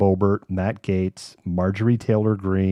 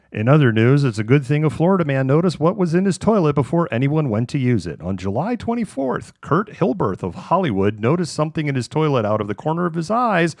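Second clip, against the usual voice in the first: bright TV anchor delivery, pitched up; newscaster voice; 4 semitones higher than usual. Shown as a demonstration. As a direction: read it like an evening news anchor. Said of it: "I'm gonna read this in my newscaster voice".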